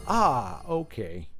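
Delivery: imitating an underwhelmed listener, sinking away; lukewarm reception. Joker mimicking the audience's response to the pun, mock lukewarm. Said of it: "What is that lukewarm reception".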